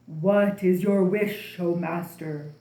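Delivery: loud, slow, low genie voice; a slow, resonant genie at his master's service; booming genie. A narrator's reading. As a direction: boom it out like a grand genie.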